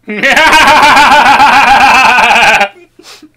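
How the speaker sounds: high loud cackling laugh; loud cackle. Real, not acted.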